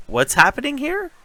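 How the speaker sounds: high-pitched mock bewilderment; baffled. Viewer reacts to King in the North scene, high baffled question.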